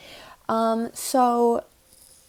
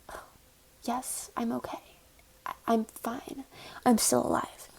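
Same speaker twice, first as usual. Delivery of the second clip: inner peace; 5 dB quieter than usual. Retold or imitated. Hit kid answers the driver, mock-serene whisper. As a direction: whisper it as if suddenly at total peace. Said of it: "I had a moment of inner peace".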